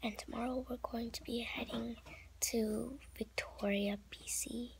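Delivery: soft night whisper, dad asleep; hushed whisper so nobody wakes. Real, not acted. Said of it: "did I mention that I'm whispering".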